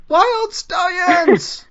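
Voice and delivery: high, loud, drawn-out rock cry; hammed-up band shout. Host playing the film duo announcing their band, hammed-up shout. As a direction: stretch it out in a high mock-rocker yell.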